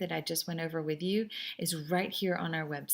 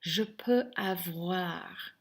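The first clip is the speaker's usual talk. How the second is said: je peux avoir said slowly, syllable by syllable; slow teacher demo of je peux avoir; slow model phrase; much slower than usual. Shown as a demonstration. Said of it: "the last way we can say this is".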